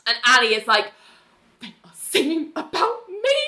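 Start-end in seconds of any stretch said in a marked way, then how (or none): 1.6-3.4 high, incredulous whisper-like gasp; imitating shocked Ali. Ali asking Renee about the choir song, high and incredulous. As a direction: sound aghast in a thin voice. Ali's alarmed quote, high.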